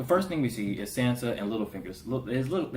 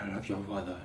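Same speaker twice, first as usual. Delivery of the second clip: softly imitated begging; hushed, half-acted plea; mock pleading; 3 semitones lower, 9 dB quieter than usual. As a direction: plead quietly, as if on your knees. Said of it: "he's just on his knees like".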